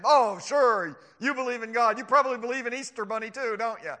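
loud sarcastic jeer; mocking scoffer. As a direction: scoff at a naive believer. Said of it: "run into a scoffer who's gonna say".

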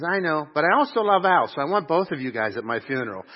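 mock-pleading to be heard; playful insisting. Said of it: "She goes".

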